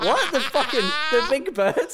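high, incredulous what; mock disbelief. Customer baffled at being served ostrich, high disbelief. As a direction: sound baffled by the idea.

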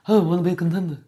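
mumbling movie tough guy; lightly imitated mumbled Brando line; Marlon Brando. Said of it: "like Marlon Brando".

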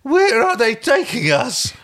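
put-on very high, slow, teary boy; tearful little child. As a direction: plead it like a scared child. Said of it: "A tear streaked down Talvo's smooth face".

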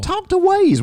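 high, loud mock outburst at the co-host; mock yelling. Co-host snaps back at partner in a mock high yell. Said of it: "Why are you yelling at me?".

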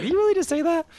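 high-pitched retold disbelief; bewildered. Coach reacting to kid's big-one shout, retold bewilderment. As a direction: squeak it out, imitating your own baffled reaction. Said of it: "with the bewildered look on my face".

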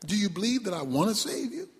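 low, soft, quick question from God; voice from heaven. Acted. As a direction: ask quietly as an unhurried-sounding God. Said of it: "The voice said".

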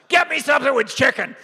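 high, far, shouted old-man imitation; crotchety old man. Bernie orders the waitress around, crotchety shout. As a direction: demand the food like a grumpy elder. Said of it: "kinda crotchety old man".

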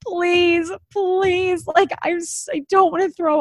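sick mom pleading at the hotel door. Retold or imitated. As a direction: beg at the door, half-joking. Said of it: "just like".

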